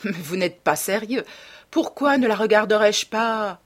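loud, incredulous questioning; amused exclamation. A narrator's reading. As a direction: protest in astonished amusement. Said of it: "s'exclama Hallward en riant".

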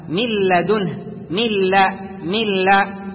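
من لدنه with the nun merged fully into the lam, no nasal hum. Shown as a demonstration. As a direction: say من لا as one doubled lam, milladunhu. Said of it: "كما قال تعالى". (إدغام كامل بلا غنة في من لدنه)

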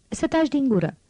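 furious order to shut up; shouted order. Acted. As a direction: snap at the crying child. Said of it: "strigă doamna Thénardier".

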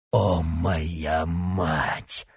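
very slow, low, seething; hateful exclamation. A narrator's reading. Mordaunt recalling his mother, slow seething hatred. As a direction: drag it out with hatred. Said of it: "воскликнул Мордаунд с горящим взглядом".

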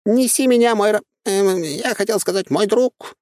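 high, light talking squirrel; chirpy little creature voice. Acted. Squirrel rides shoulder.

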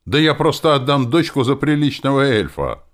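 high, loud indignant retort. A narrator's reading. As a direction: protest as an outraged father. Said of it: "возмутился зодчий Иглет". (возмущённо, громко и высоко)

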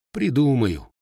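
dismissive. A narrator's reading. Matvei shrugs off the heating question, dismissive. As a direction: wave the worry away in a word. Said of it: "отмахнулся Матвей".

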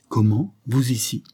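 exclamation surprise, un peu plus haut; lance-le avec étonnement; s'écrie surpris. A narrator's reading. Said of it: "s'écria Carlyle". (Carlyle découvre sa sœur sur le perron, s'écrie surpris)

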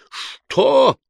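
high, loud furious roar. A narrator's reading. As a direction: bellow the one word in fury. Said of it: "взревел инквизитор".

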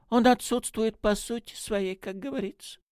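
little priest. A narrator's reading. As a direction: explain it in a high voice, easing off at the end. Priest voiced in a story. (священник высоким голосом)

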